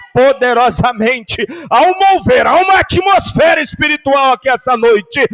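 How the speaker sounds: loud, high, urgent preaching; rally the congregation to the altar; full-force altar call. Acted.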